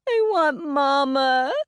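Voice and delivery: put-on high, slow, crying little girl; sobbing child. A narrator's reading. Grieving little girl crying for her dead mother, sobbing. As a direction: wail it through tears. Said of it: "Addie sobbed".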